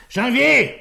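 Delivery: loud high call. Acted. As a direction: call Janvier in from the next room. Calls inspector into office.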